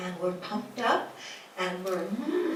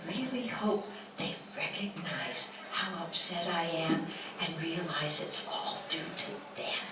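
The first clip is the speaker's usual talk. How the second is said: quiet inner voice; 4 semitones lower, 6 dB quieter than usual. Retold or imitated. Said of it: "one part of our mind says".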